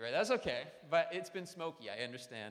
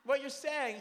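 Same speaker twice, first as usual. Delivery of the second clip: exasperated parent; 12 semitones higher, 9 dB louder than usual. Retold or imitated.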